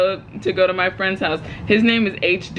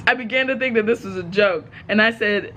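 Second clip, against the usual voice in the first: usual speaking voice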